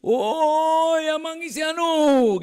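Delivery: long, high, very loud holler; hill-to-hill shout. Shown as a demonstration. Preacher demonstrating shouting from hill to hill back home, long high yell. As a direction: call out across the hills with stretched vowels. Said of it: "dari satu bukit ke bukit lain saya teriak".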